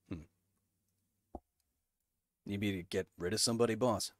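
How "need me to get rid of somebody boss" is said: soldier henchman voice. Retold or imitated. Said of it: "I'm kinda quick, I'm kinda witty".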